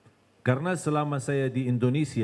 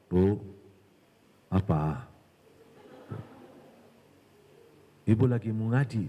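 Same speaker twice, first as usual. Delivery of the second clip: low-pitched sly tempter approaching; bisikan syaitan; 6 semitones lower than usual. Acted. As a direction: play the devil sidling up, pitched low. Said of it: "Didatangin syaitan". (setan menggoda dengan nada rendah)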